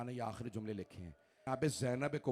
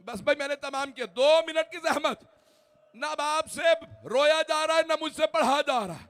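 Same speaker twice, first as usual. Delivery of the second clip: full-force mourning recitation; 11 semitones higher, 13 dB louder than usual. Acted. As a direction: cry the masaib out to the whole majlis. Majlis lament at full force. (पूरे ज़ोर से मसाइब पढ़ना, ऊँची चीखती आवाज़)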